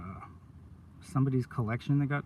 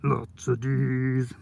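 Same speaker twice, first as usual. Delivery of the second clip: slow suggestive drawl; 4 dB louder, much slower than usual. Real, not acted. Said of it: "innuendo joke repeated slowly".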